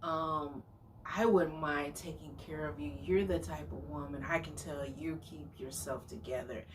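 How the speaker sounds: soft, low imitation of a smitten date; laying it on thick. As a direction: murmur flattery like an overeager suitor. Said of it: "he just kept laying it on thick".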